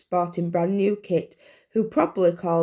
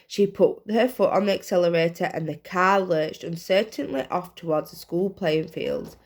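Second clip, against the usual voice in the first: usual speaking voice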